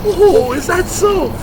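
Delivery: sarcastic. Acted. Sarcastic comeback to insult.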